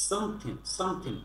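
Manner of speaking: "something" ending in "-thin", no g; teacher models "something" as "some-thin"; dropped final g. Shown as a demonstration. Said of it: "এভাবে শিখতে হবে".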